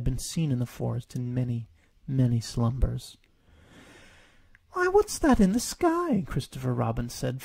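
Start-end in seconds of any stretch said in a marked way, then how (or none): 4.7-6.2 put-on much higher, startled boy's question; puzzled frown. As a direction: look up at a strange sky, puzzled. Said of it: "frowning up at the whirling dark".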